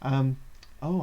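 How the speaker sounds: ordinary talk, nothing marked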